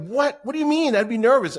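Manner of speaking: very high, loud mock disbelief; incredulous. Nervous onlooker reacting to excited stage speakers, acted incredulity. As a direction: squawk it back as if it makes no sense.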